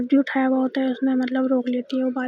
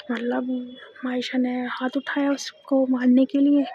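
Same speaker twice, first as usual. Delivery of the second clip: usual speaking voice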